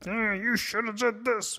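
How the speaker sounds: high-pitched nagging authority voice; scolding parent. Acted. Inner critic judging you for what you missed, scolding parent. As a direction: nag like a disapproving teacher. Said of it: "It sounds like your parents, your teachers".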